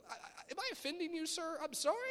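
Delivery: high, fast, sheepish little voice; mock timid apology. Teen answers the preacher with a high mock apology. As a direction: squeak it out like a scolded kid. Timid reply to the praying speaker.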